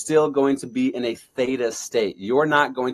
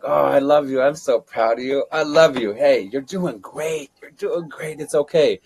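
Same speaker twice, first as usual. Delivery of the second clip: tender self-talk; 4 semitones higher, 3 dB louder than usual. Shown as a demonstration. Speaker demonstrating self-talk to viewers, tender. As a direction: reassure yourself like a child. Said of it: "Physically hold yourself. Like".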